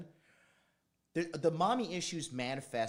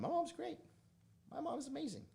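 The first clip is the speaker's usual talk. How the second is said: mock gushing. Gushing about mom, squeaky voice.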